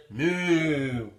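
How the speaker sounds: mooing cow sound. Acted. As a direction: moo like a cow.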